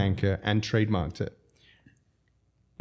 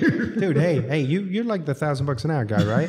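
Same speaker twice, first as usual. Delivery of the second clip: higher, lightly hammed-up call to a lawyer; mock phone caller; 5 semitones higher, 3 dB louder than usual. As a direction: pretend to ring up a lawyer, half laughing. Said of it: "You call the lawyer".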